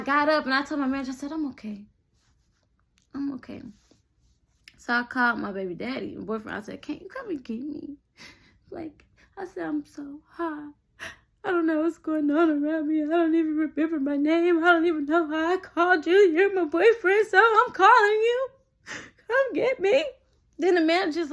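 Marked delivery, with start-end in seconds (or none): 1.3-3.6 small, soft acted voice; quiet reassurance. Telling manager she's okay, acted quiet reassurance. 6.8-10.7 small, soft mock plea; quiet pleading. Calling boyfriend for a ride, acted quiet plea. 11.4-20.0 high whining fake cry, climbing higher; mock tearful panic. Calling boyfriend to get her, acted crying panic.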